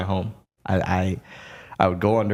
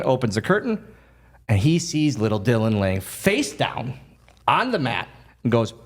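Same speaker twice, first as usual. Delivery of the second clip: ordinary talk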